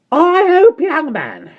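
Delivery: high, loud scornful retort. A narrator's reading. Landlady to Michael, scornful and high. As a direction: toss your head and sneer.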